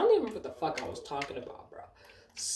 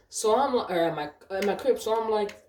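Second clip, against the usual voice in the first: normal talking voice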